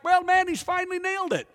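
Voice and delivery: high, mock-impressed dad voice; imitating the dad. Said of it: "dad is like".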